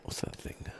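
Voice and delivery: ordinary talk, nothing marked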